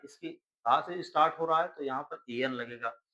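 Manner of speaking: usual speaking voice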